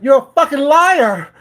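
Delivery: loud, high mock shout of the girl; imitated yell. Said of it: "She's like".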